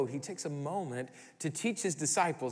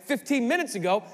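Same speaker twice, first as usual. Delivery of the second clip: ordinary talk, nothing marked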